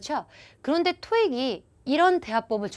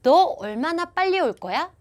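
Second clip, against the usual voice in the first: higher, louder, slower question; mock question, higher and louder; friend asking; 6 semitones higher, 5 dB louder than usual. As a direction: press the friend for an answer. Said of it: "반면에 이런 거죠".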